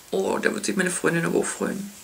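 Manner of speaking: soft conspiratorial whisper; hushed aside about her friend. Real, not acted. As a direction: whisper it, pleased.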